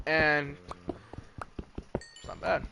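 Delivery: slow, savoring "And it's not bad"; drawn out. Real, not acted. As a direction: stretch every word out very slowly, lingering on "bad". Musing on a cheap contract.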